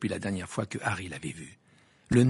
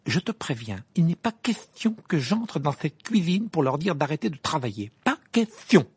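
raised, firm refusal; 5 semitones higher, 3 dB louder than usual. A narrator's reading. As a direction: put your foot down. Ron refusing firmly.

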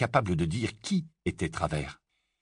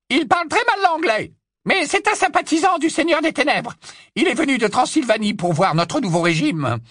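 haughty witch voice; 6 dB louder than usual. Acted. Hermione as Bellatrix.

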